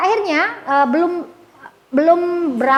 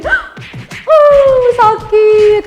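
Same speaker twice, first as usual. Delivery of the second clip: high, loud mock cry of pain; imitated child's wail; 9 semitones higher, 7 dB louder, much slower than usual. Boy just punched by his friend, mock pained wail. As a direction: wail like a punched little kid. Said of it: "Terus dia gini".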